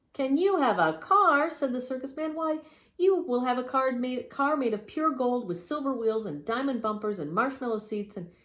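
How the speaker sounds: loud, high boastful showman; grand showman voice. Acted. Circus man boasts.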